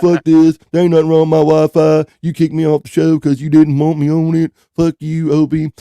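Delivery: imitating a furious guest, half laughing; mock angry rant. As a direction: play the kicked-off guest blowing up at Opie. Voicing friend's angry rant.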